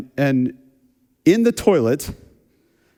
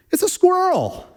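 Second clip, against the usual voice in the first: surprised reveal; 12 semitones higher than usual. Real, not acted. Reveal of the squirrel.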